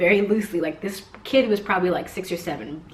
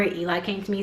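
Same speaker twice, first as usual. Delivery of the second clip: ordinary talk, nothing marked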